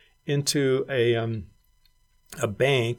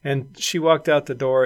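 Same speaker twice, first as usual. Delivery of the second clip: ordinary delivery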